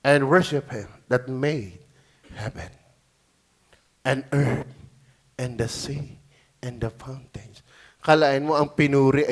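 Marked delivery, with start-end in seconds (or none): 0.0-7.6 low, soft, slowed reverent reading; preacher softly rereads the verse in awe; hushed reading. Acted. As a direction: drop to a near hush for the verse.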